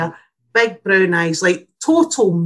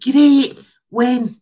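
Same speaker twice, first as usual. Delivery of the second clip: high, loud burst of delight; imitating a keen, upbeat patient; eager reply; 6 semitones higher, 5 dB louder than usual. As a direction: chirp it back, all for it. Mum's eager reply retold.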